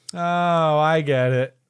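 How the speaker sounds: ordinary talk, nothing marked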